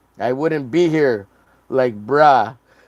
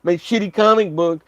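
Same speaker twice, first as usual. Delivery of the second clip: mock begging artist; 7 semitones higher, 3 dB louder than usual. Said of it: "you're like".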